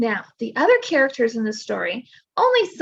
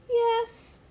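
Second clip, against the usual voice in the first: a tiny, much higher squeaked yes; 10 semitones higher, 3 dB quieter than usual. Acted. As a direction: squeak it like a small shy kitten. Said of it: "squeaks Kitty".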